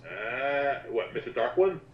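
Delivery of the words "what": ordinary talk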